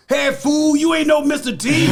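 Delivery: high, loud mock holler; fans hollering. Fans yelling from the street, loud mock holler. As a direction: holler it like a fan across the street.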